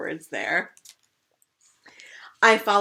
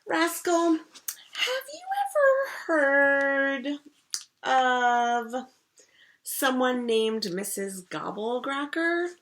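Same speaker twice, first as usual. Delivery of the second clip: slow, drawn-out spooky question; very slowly; 7 semitones higher, much slower than usual. Acted. Big sister slowly teasing her little sister, drawn out and spooky. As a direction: stretch every word to build suspense. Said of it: "Very slowly, Violet asks me".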